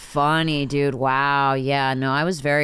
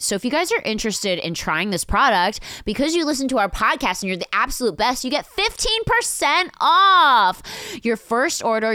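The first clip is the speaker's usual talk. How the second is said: excited ad read; 8 semitones higher than usual. Acted. Pitching the promo discount.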